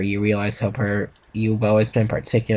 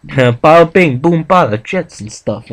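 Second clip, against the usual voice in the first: hammed-up New York accent, higher and louder; mock New York accent; 5 semitones higher, 6 dB louder, slower than usual. Teasing friend about his accent, in New York accent. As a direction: play a cartoon New Yorker. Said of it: "I'm me in New York here".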